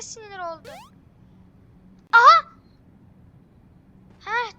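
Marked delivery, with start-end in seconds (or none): 2.1-2.5 high, very loud exclamation; startled shout. Real, not acted. Gamer surprised by the game, high very loud shout. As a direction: burst out in surprise.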